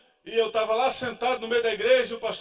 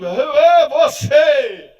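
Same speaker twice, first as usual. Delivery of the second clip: drawn-out shout, much louder; shouted accusation; 5 semitones higher, 14 dB louder, much slower than usual. Retold or imitated. Pastor in the pulpit seeming to single him out, shouting. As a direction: call it out slow and loud, pointing.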